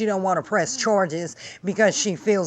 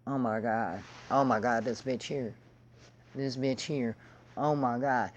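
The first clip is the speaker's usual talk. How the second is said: low, much softer, muttered disbelief; stunned disbelief; 6 semitones lower, 9 dB quieter than usual. Real, not acted. Reacting to the guest's story, hushed appalled disbelief. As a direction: drop to a hushed, appalled groan.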